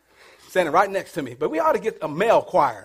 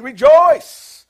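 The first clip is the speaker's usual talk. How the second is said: loud, raised call to rejoice; exultant call; 5 semitones higher, 10 dB louder than usual. Acted. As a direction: ring it out to the congregation. Calls out the verse's first word.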